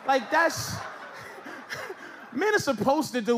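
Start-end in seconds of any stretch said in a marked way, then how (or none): 0.8-2.3 airy laugh. Real, not acted.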